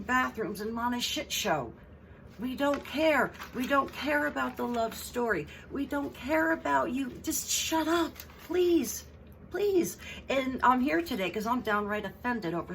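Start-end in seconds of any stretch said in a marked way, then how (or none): 2.4-7.1 high, fed-up rant; we don't care, pushed up high; exasperated. Real, not acted. Vlogger ranting at Meghan in high exasperation. 7.2-10.0 high squeaky plea to stop; pleading. Real, not acted. Vlogger begging Meghan to shut up in a high squeal.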